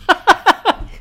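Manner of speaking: sudden high burst of laughter; cracking up high. Real, not acted. Streamer laughing at the rookie crying, high laugh.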